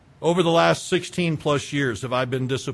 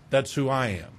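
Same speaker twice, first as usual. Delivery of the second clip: ordinary delivery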